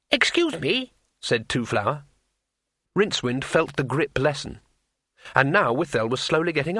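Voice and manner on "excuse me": much higher, piping polite voice; polite interruption. Acted. Tourist interrupting a strangling, politely high-pitched.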